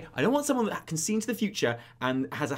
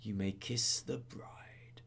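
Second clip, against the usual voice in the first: put-on tired old whisper; 6 semitones lower, 10 dB quieter, much slower than usual. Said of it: "He'd be like".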